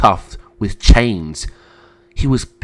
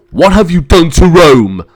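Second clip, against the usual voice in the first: loud, raised accusation; angry; 6 semitones higher, 10 dB louder than usual. Acted. Brother confronting the emperor, angry. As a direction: demand it in outrage. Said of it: "Maximus's eyes turned to anger".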